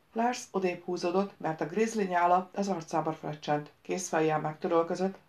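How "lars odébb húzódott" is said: ordinary delivery, nothing marked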